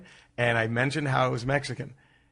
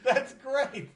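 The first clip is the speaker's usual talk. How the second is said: real laughter through the words, pitched way up; words squeezed out through a laugh; 11 semitones higher, 5 dB quieter, much slower than usual. Real, not acted. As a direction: laugh helplessly at the news. Laughing at lost recording.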